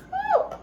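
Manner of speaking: sudden high-pitched whoop of glee; high excited woo. Real, not acted. Cook cheering at the camera mid-recipe, high excited whoop. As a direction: let out a loud delighted woo.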